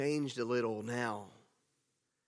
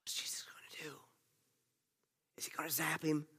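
whispered like a hushed crowd holding its breath; 10 dB quieter, faster than usual. Acted. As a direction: breathe it out, no voice, full of suspense. Imagined hushed crowd wondering.

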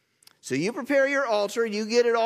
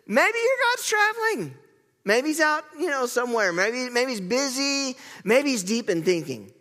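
higher, mocking teasing lilt; sarcastic taunt; 6 semitones higher than usual. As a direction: needle them like a cocky prophet. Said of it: "a little bit of extra sarcasm".

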